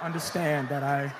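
low, slowed fake farewell; hammed-up last words to mom; mock tearful goodbye. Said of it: "I would look at my mother like".

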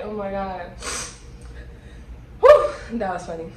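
ordinary talk

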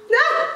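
high delighted shriek; sudden high excited scream. Real, not acted. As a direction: squeal with glee. Excited shriek after call.

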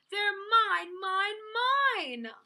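high, loud greedy outburst, falling at the end; possessive shouting. A narrator's reading. Minosaur grabbing the blocks, shouting possessively. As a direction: shout to claim every one. Dinosaur grabs blocks and frowns.

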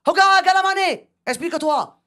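high, loud mock angry call; hammed-up chief's anger. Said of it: "il s'énerve, il lui dit".

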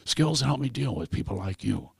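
low, hushed menace of a film father; Liam Neeson. Acted. Comedian doing a movie threat to a kidnapper, low and menacing. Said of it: "Liam Neeson".

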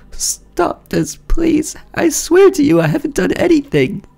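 frightened wife. Acted. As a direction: beg him to believe you, voice climbing. Wife pleads with jealous husband.

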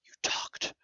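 accusing whisper, voiced as Gilchrist. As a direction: breathe it out in shocked disbelief. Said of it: "looked at him like".

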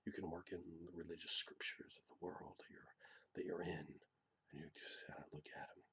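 low, soft whisper; hushed menacing whisper. Shown as a demonstration. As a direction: lean in and whisper the threat. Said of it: "sit back and talk real low".

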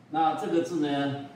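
ordinary talk

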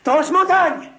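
urgent high shout for a friend; calling out. Acted. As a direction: yell his name across the camp. Calling for help from afar.

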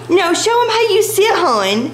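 high, cutesy pleading; gasp, then coax the pet high; squeaky dog-owner voice. Real, not acted. Coaxing her dog to perform.

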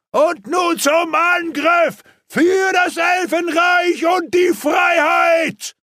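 slow, high rallying shout; battle cry. A narrator's reading. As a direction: roar them into the attack. Battle cry before the charge.

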